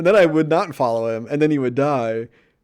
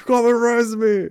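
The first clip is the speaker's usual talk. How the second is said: high, drawn-out imitation of a screaming gamer; mock scream; 9 semitones higher, 3 dB louder than usual. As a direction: wail for rescue like a frantic teammate. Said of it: "scream their names and be like".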